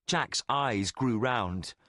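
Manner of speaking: plain reading voice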